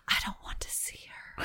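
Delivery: breathy quick whisper. Retold or imitated. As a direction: hush it out fast under your breath. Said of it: "Rachel whispers".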